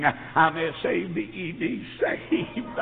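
slow letter-by-letter spelling; spelled out. Shown as a demonstration. Son spelling the word to his mother, slow. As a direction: spell each letter slowly. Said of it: "you spelled it wrong".

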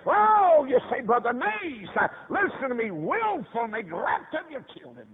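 high, crying exhortation; impassioned preaching. Real, not acted. Preacher pleading with parents to listen, high urgent cry.

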